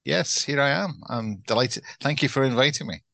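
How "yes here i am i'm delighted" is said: usual speaking voice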